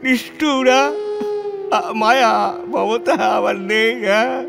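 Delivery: high, broken sobbing with halting questions; weeping. Acted. Lonely reformer asks if he is heartless, sobbing. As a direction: break down, crying through the words.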